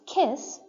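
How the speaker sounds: "kiss" with a clearly audible, aspirated k; "kiss", k clearly sounded at the start. Shown as a demonstration. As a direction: say "kiss" with a puff of air on the k. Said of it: "you can clearly hear me saying the k sound".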